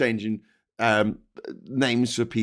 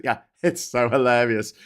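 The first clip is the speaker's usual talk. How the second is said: ordinary talk, nothing marked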